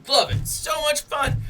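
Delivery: excited gush. Real, not acted. Gushing about seeing plays performed.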